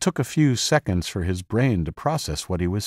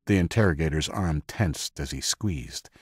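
ordinary delivery

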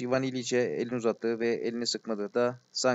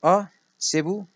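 high, louder exclamation, drawn out; delighted cry; 6 semitones higher, 5 dB louder, slower than usual. A narrator's reading. Glafira recognises the man and rushes to him, delighted cry. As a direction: cry out on seeing him, pitch leaping up. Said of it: "diye çığlık attı Glafira Petrovna".

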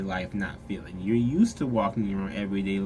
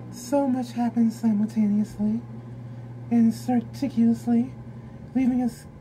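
book reading; 11 semitones higher than usual. Acted. Tarot reader reading the card guidebook, high and solemn. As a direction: read the card text like an oracle.

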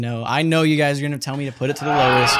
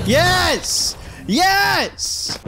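high, exultant shout; triumphant cry; 12 semitones higher than usual. Real, not acted. Player celebrating one down, triumphant high cry.

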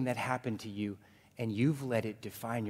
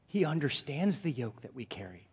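normal talking voice

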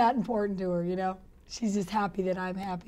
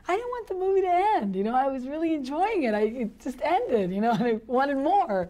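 much higher and louder, mock gushing fan; imitating delighted fans; 5 semitones higher, 3 dB louder than usual. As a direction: gush like a thrilled moviegoer.

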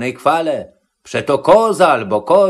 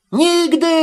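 very high-pitched refusal; sudden high goat cry, no extra volume; Matołek crying out; 13 semitones higher than usual. A narrator's reading. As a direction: squeal it in protest. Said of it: "krzyknął nań koziołek".

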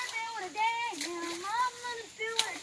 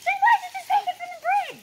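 calling out; 10 semitones higher, 13 dB louder, faster than usual. Real, not acted. Calling child off the bridge.

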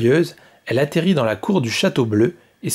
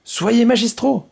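high, louder eager order, last words slowed; excited little king, pitched up; eager exclamation; 10 semitones higher, 4 dB louder than usual. Acted. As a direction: call for the artisans full of excitement. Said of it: "s'exclama le petit roi".

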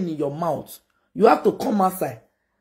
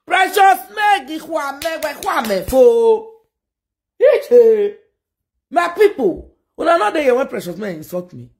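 outraged shout; 7 semitones higher than usual. Real, not acted. Host yelling to viewers that Precious Meg insulted her, outraged. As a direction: cry out to the crowd in protest. Calling out to audience.

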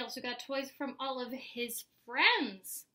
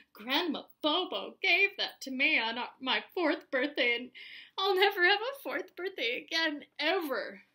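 overwrought, louder plea; dramatic; 3 semitones higher, 5 dB louder than usual. A narrator's reading. Spencer pleads over a birthday gift, melodramatic. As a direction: build to a high, aggrieved wail. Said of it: "Don't be so dramatic".

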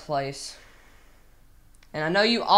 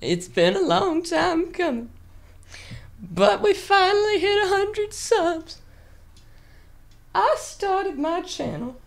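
much higher, sung mock-weepy voice; silly put-on voice; 12 semitones higher than usual. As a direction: croon it like a fake tearful ballad. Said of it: "gonna stop doing this voice".

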